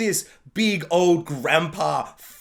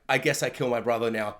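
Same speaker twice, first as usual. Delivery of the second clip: much lower, flat offhand line; bored villain aside; deadpan; 9 semitones lower, 4 dB quieter than usual. Retold or imitated. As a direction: shrug it off coldly. Schemer quoted.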